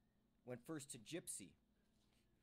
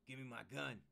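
snarled; 7 dB louder than usual. A narrator's reading. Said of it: "he snarled".

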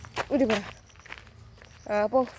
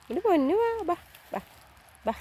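much higher sweet coaxing to a pet; 8 semitones higher than usual. Real, not acted. Coaxing pet cat to come home. (ഉയർന്ന കൊഞ്ചൽ ശബ്ദത്തിൽ വിളി)

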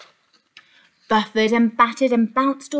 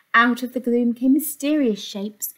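usual speaking voice

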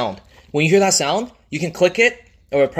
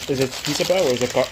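usual speaking voice

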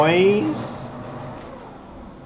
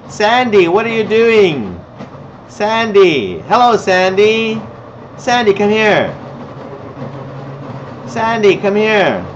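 high calling voice, falling at the end; 6 semitones higher than usual. Pretend. Teacher calling Sandy to come here, high calling voice. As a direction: call a pet from across the room, high.

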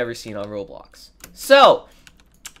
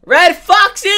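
excited call; 11 dB louder, slower than usual. Real, not acted. Excited greeting call to friend.

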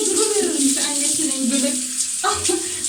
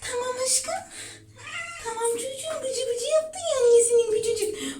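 high, soft cooing to a pet; 10 semitones higher, 6 dB quieter than usual. Real, not acted. Soothing her wet cat after the bath, high soft coo.

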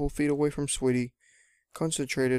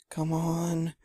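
higher, held-out urging; a straining self-urge, pitched up; urging herself on; 4 semitones higher than usual. A narrator's reading. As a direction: draw it out higher the second time. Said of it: "Sweetie kept saying".

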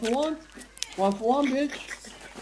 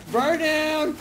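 real taunting shout; 4 semitones higher, much slower than usual. Real, not acted. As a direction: yell it like a taunt mid-fight. Calling out in game fight.

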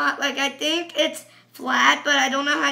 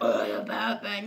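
low, softer Batman voice; dark Batman voice; 4 semitones lower, 6 dB quieter than usual. Shown as a demonstration. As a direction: put on a deep dark hero voice. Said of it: "instead of sounding like".

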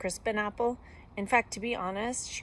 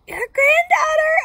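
very high, loud, slow squeak; 17 dB louder than usual. A narrator's reading. Wolf posing as granddaughter at the door, loud high squeak. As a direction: squeal it in a fake little-girl voice. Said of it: "I squeaked".

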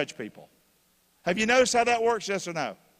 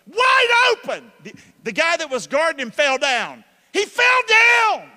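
yelling wide open at the top of his voice; excited shouting; 10 semitones higher, 9 dB louder than usual. Acted. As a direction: shout it like a fan watching the play. Preacher acts out a football play.

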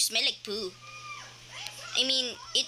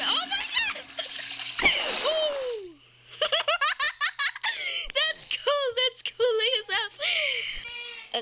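much higher, laughing delight; excited squeal; 12 semitones higher, much slower than usual. Real, not acted. Delighted by the game event.

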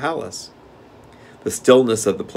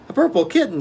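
put-on little boy voice; 14 semitones higher, 4 dB louder, faster than usual.

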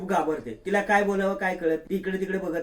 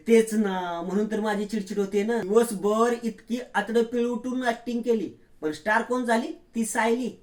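lightly voiced scheming woman, pitched higher; a hint of a sneering villainess, rising higher; scheming daughter-in-law; 6 semitones higher than usual. Priya sneering to her husband, lightly voiced scheming woman. Priya complains about Sayali.

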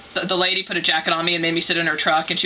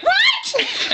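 extremely high mock shriek; comic high-pitched outburst; imitated mom freaking out. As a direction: squeal it like a panicking mom. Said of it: "starts freaking out on the phone".

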